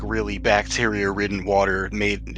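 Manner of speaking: normal talking voice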